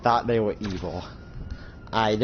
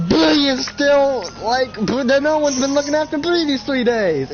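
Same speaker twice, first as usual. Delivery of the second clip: panicked alarm; 14 semitones higher, 5 dB louder than usual. Acted. Realizing nobody watched Bree, he cries out high in alarm.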